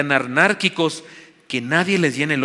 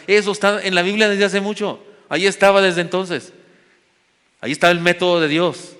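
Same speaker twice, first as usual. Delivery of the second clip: higher, louder preacher's proclamation; preaching at full force; 6 semitones higher, 5 dB louder than usual. Acted. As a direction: proclaim it to the congregation. Preaching climax. (predicación con fuerza, más alta y más fuerte)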